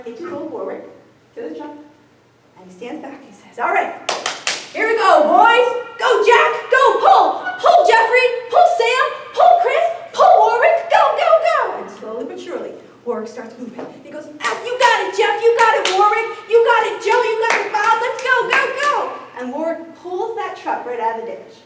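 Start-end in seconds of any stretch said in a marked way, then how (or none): 3.6-11.8 much louder and higher, yelling the names; rally the imaginary team at full voice; farmer shouting to the team. Acted. 14.4-19.2 loud, high encouraging yells; cheering shout. Acted. Farmer cheering on the pull.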